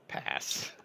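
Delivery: breathy whispered refusal; whispered pass. Real, not acted. Refusing an overpriced gun.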